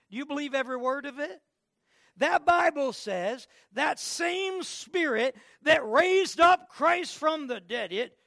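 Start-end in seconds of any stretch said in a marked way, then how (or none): 2.2-8.2 slow, loud, high preacher's cry; fiery preaching. Acted. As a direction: preach it at full force. Preaching at full force.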